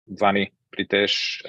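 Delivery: usual speaking voice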